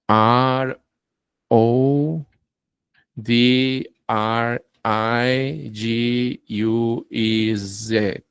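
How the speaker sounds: "R O D R I G U E Z" spelled letter by letter, slow; slow spelling. Shown as a demonstration. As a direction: spell it out slowly, one letter at a time. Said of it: "deletrean un apellido largo". (deletreo lento, letra por letra)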